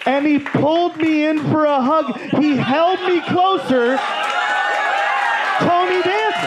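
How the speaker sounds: building excitement, loud and high; excited climax. Real, not acted. Telling the crowd the Danza hug, excited.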